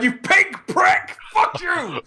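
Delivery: high slow yelled insults at the screen; mock drunk abuse. Drunk friends yelling at a cartoon, high and slow. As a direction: scream abuse at Hot Rod. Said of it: "Just going".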